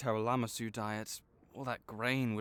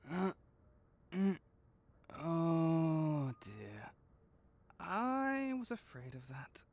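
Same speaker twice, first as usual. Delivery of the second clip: worried sigh; 4 semitones higher, slower than usual. Acted. Vet sees infected tooth plate.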